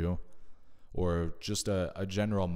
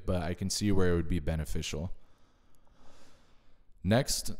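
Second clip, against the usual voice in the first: ordinary talk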